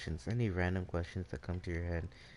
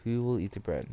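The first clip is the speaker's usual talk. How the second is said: mild storybook call, a bit louder; 2 semitones higher, 7 dB louder than usual. A narrator's reading. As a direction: call the question out to the farmyard. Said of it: "Red Hen called".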